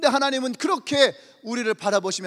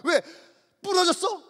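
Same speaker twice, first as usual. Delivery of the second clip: much higher, rising to a shout; frantic mother; 7 semitones higher than usual. Acted. Overprotective mother shouting questions about the injury. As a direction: escalate into panicked questions.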